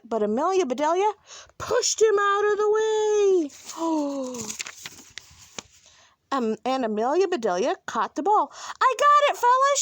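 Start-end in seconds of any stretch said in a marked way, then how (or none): 1.6-3.5 higher, heightened storytelling; high-pitched storybook drama; dramatic narration. A narrator's reading. 3.5-4.9 soft, drawn-out gasp; falling "oh" of shock; storyteller's gasp. A narrator's reading. Reader acts shocked gasp. 8.8-9.8 high, loud, excited call; triumphant high-pitched holler. A narrator's reading. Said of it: "she called".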